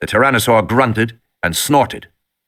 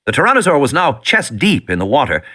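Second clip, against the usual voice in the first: ordinary delivery, nothing marked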